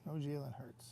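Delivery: ordinary delivery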